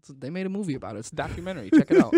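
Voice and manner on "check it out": much higher and louder, hammed-up promo shout; mock enthusiastic pitch. Joking to his friend about a dog movie, hammed-up loud pitch. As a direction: sell it like a cheesy ad.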